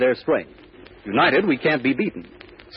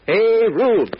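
high, loud rallying cry; urgent carnival call to arms; shout; 8 semitones higher, 5 dB louder than usual. Acted. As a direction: shout it across the fairground for help. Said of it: "and then shouts".

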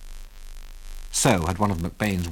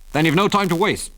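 harsh; 8 semitones higher, 3 dB louder than usual. A narrator's reading. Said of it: "said Delaney harshly".

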